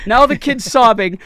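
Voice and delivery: ordinary talk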